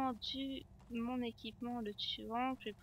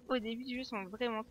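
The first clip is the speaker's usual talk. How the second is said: ordinary delivery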